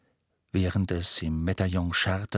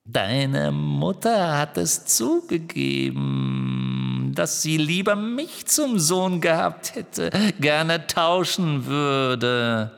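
slow, cooing evil taunt swooping high; drawn-out, high-swooping phantom mockery; Riddle-Hermine; 4 semitones higher, much slower than usual. A narrator's reading. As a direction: coo cruelly, stretching the words out. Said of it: "gurrte Riddle-Hermine".

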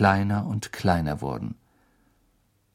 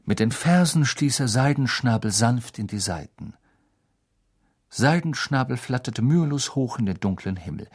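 ordinary delivery

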